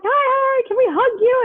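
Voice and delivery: squeaking friend. Retold or imitated. Friend asking the band for hugs, imitated high-pitched squeaking. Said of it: "she's, like, squeaking at them".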